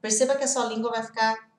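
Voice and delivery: ordinary talk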